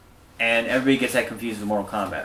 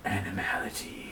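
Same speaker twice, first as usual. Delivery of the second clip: breathy whispered game callout; whispered announcer; 4 semitones lower, 11 dB quieter than usual. Pretend. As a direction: whisper it like a secret game move.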